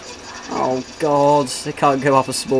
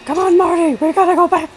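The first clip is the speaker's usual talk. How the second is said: put-on high, loud excited old scientist; frantic mad scientist squeal; Doc Brown impression; 5 dB louder than usual. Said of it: "That's Christopher Lloyd as well".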